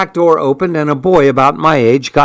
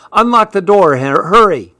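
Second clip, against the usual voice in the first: raised-pitch holler, narrated with restraint; 6 semitones higher than usual. A narrator's reading. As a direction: call it out higher and urgently, without really yelling. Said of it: "she hollered".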